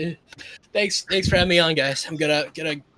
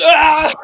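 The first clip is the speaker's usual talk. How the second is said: sudden yell; 11 semitones higher, 9 dB louder than usual. Acted. Anime voice bit, sudden yell.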